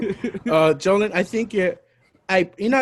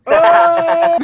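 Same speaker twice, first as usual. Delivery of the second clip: a big high "Oh!" shouted in shock; real shout of surprise; surprised shout; 12 semitones higher, 10 dB louder than usual. Real, not acted. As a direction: blurt it out at the reveal. Reacting to John Cena guess.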